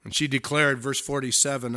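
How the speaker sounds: ordinary talk, nothing marked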